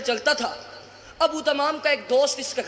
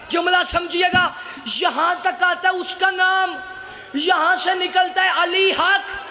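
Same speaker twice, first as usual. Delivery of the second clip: impassioned preaching; 5 semitones higher, 5 dB louder than usual. Acted. Preacher telling the congregation of children refusing the bribe, impassioned preaching. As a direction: drive the tale up to a ringing shout.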